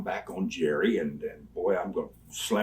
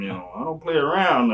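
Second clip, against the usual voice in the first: normal talking voice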